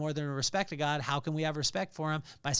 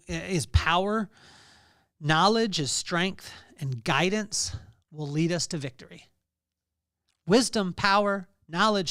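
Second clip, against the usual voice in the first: louder, slow, building sermon voice; preaching at full force; 2 semitones higher, 5 dB louder than usual. Acted. Pastor preaching the verse to his congregation, forceful. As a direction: drive each word home.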